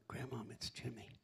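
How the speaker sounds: soft unvoiced whisper. Grandson waking his sleeping grandmother, whispering. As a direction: gently wake her.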